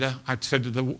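ordinary talk

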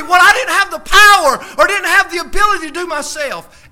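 loud, high, shouted preaching; preacher building to a loud shout; full-force preaching. Real, not acted. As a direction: shout it out to the congregation.

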